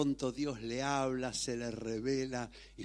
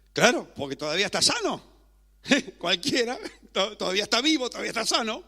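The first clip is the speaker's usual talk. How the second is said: loud, higher, quick dismissive retort; scoffing devil; 8 semitones higher, 8 dB louder, much faster than usual. Acted. Devil answering God about Job's health, scoffing. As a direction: scoff with a laugh, voice raised.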